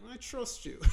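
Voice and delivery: high, hushed mock trust; tiny mock-naive voice; gullible suspect. Gullible suspect answering good cop.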